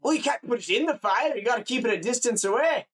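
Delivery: high, scolding Scottish farmer. Acted. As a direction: tell them off as a Scottish countryman. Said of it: "like a Scottish person or whatever".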